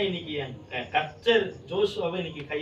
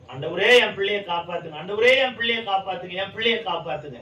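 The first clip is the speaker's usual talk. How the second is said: crying out to God, much louder; desperate loud prayer; 3 semitones higher, 7 dB louder than usual. Retold or imitated. Father re-enacting his desperate loud prayer for his son. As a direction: plead loudly for the child's life.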